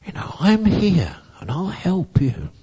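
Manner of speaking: hug her with your voice; loving comfort. A narrator's reading. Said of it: "spoke lovely things into her ear".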